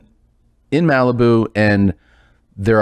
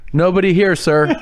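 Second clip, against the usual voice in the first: high-pitched imitation of an innocent friend; higher, put-on polite denial; mock innocence; 7 semitones higher than usual. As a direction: play dumb for the officer, pitched up. Friend feigns innocence to patrol officer.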